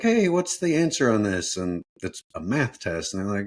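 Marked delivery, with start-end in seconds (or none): 0.0-1.6 pleading student. Retold or imitated. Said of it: "if you ask your teacher, like".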